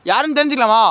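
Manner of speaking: high, loud question into the dark; calling out. Real, not acted. Ghost hunter asks the spirit who it is, loud and high. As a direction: call out and ask who's there.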